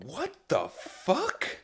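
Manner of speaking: high, slow mock bewilderment; soft, drawn-out stunned disbelief; bewildered reaction. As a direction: act out being taken aback. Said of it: "And I'll go".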